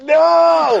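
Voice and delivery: high, loud, drawn-out no; comic long shouted no. Retold or imitated. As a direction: yell the refusal long and high. Refusing friend's taunt.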